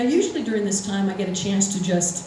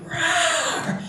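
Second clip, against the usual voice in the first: higher, hammed-up monster "Rawr!"; Bigfoot roar; 8 semitones higher than usual. Eight-foot Bigfoot roars behind her.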